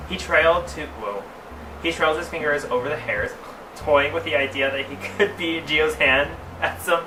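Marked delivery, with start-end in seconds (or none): none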